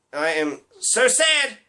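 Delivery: mock sadness blurted as an exclamation; slow lead-in, then a high, punched 'sad'; exclaimed sadness. Comic mocking an insincere condolence tweet, exclaimed. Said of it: "exclamation point".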